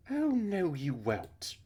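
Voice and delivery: high, loud scolding cry, dropping at the end; scolding interception. Acted. Missus Burns catching someone scooting away, loudly scolding. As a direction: cut them off with a high, loud refusal.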